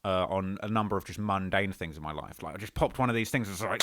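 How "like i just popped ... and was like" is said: normal talking voice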